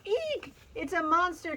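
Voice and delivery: high squeal of fright; scared duck shrieking Eek; frightened duck cry. A narrator's reading. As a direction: squeak it out in panic. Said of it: "cried the duck".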